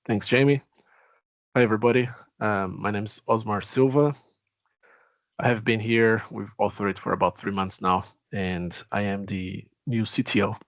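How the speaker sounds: ordinary delivery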